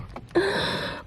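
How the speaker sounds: sharp unvoiced fright gasp; mock gasp. Scaring herself about a stranger, mock gasp. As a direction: suck in air as if startled. Scared of someone at window.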